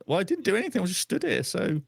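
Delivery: ordinary talk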